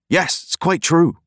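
high, slow, earnest; earnest insistence. A narrator's reading. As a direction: insist it's really true. Lad affirms.

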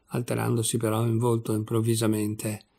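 plain reading voice

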